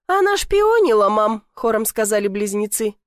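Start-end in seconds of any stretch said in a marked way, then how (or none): 0.1-1.4 high, loud accusation; tattling. A narrator's reading. Twins tell their mother on the girl, high loud tattling. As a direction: tell on her to Mum.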